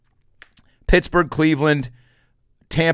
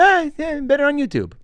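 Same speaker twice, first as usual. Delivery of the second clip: much higher, dismissive mock voice; a bored audience member shrugging off the show, much higher and dismissive; unimpressed audience member; 14 semitones higher than usual. High, dismissive mock critic. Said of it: "you're gonna go".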